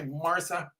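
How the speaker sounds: ordinary talk, nothing marked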